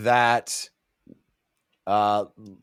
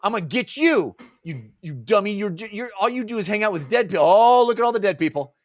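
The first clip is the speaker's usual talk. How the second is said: high, hammed-up petulant rant; mock angry taunting; 6 semitones higher, faster than usual. Olar Ithil mocking Talk and his dead company, playfully angry. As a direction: taunt in a playful fury, pitched up.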